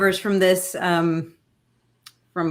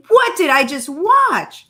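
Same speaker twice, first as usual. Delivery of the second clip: higher, incredulous repeat; stunned, pitched-up disbelief; 6 semitones higher, 4 dB louder than usual. Real, not acted. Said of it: "can we just talk about it".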